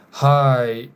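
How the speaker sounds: ordinary talk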